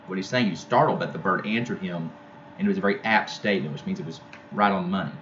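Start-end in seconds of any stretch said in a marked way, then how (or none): none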